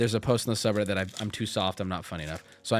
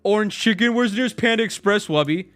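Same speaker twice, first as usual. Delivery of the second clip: mock outrage; 5 semitones higher, 6 dB louder than usual. Mock outrage at racist joke.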